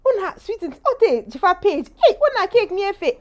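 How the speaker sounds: high loud excited neighbour voice; eager neighbours. Retold or imitated. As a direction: gush over the famous cake maker.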